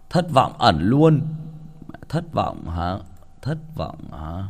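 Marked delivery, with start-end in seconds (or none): 2.1-4.4 quiet aside. Acted. Muttered repeat aside.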